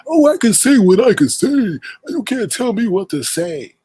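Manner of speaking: high, drawn-out mocking voice; loud, high taunt that slows at the end; mocking quote. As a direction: mimic her in a high singsong. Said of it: "and you keep saying".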